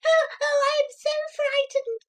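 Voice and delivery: put-on very high, little boy crying out; child's wail. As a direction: wail like a terrified small boy. Terrified child sobs.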